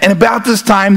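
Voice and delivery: ordinary delivery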